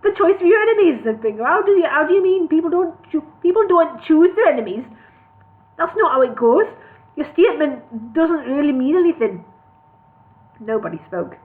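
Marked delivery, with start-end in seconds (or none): 0.0-3.2 put-on Newcastle accent. Hobbit Bingo challenging the elf in Newcastle accent. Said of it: "I'll start that again". 3.4-4.9 put-on "people don't choose their enemies" in Newcastle accent. Bingo retaking the line in Newcastle accent. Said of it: "I'll start that again". 5.8-9.4 put-on Newcastle accent. Hobbit Bingo challenging the elf in Newcastle accent. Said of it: "I'll start that again".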